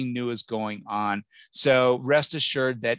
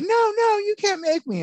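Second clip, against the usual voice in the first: put-on much higher, louder childish wail; whiny child protest; 5 dB louder than usual. Parent recalls nervous kid on first school day, high childish wail. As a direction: whine like a kid dragged to school.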